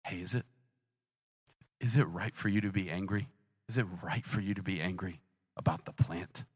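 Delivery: soft, low, tender question; quiet, loving voice that doesn't judge; gentle. Acted. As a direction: ask it gently, with long pauses. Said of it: "Do you see how gentle God is".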